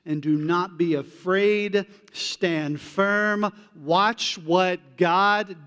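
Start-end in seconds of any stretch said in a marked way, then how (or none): none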